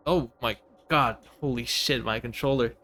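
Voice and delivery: usual speaking voice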